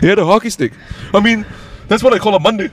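proud small-town cop brag; mock Canadian cop. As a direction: play a cheerful Canadian cop bragging. Voicing Canadian cop, joking.

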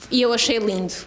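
ordinary talk

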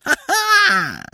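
very high, piercing "ha-ha"; short shrill laugh. A narrator's reading. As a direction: burst out in a brief, shrill cackle. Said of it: "shrill laugh".

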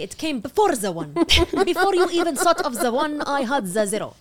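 put-on light Arabic accent, gloating. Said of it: "Notice my nuanced accents".

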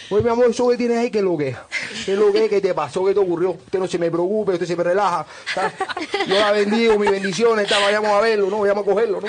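put-on loud, high Cuban accent, fussing and fast; hammed-up Cuban guide fretting over her foot. Cuban guide fusses over tourist's stuck foot, loud high Cuban accent. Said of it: "¿Qué te dijo algo así o no?".

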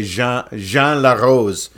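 Jean Larose slow and French, zhahn; French pronunciation. Shown as a demonstration. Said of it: "with a name like Larose".

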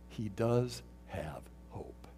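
slow hushed whisper; soft, intimate whisper. Real, not acted. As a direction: drop to a near whisper for weight. Drops to a whisper.

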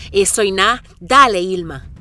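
shouting herself on; loud self-cheer. Real, not acted. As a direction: push yourself with a yell. Se grita ánimo en la pendiente. (grito para animarse)

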